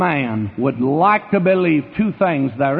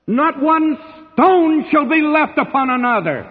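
ringing, high-pitched pulpit proclamation; stretched, soaring oratory; declaiming scripture; 4 semitones higher than usual. Retold or imitated. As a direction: draw out each phrase like a prophet pronouncing doom. Preacher declaiming Jesus' lament over Jerusalem.

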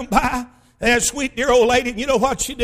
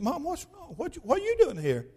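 put-on soft, higher-pitched child's questioning; worried child; 5 semitones higher, 9 dB quieter than usual. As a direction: voice an anxious kid, hushed. Worried children question crying mother.

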